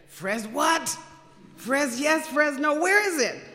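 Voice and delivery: loud, high-pitched incredulous questioning; baffled disbelief. Retold or imitated. Answering the Lord's call to Fresno, loud bewildered disbelief. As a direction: balk at an unheard-of place name, pitch climbing.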